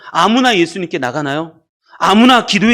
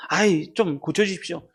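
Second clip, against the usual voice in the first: half-hearted request; 6 dB quieter than usual. Acted. Preacher mimics a parent asking Jesus without urgency, half-hearted. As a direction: ask as if it hardly matters. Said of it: "마시려면 마시고".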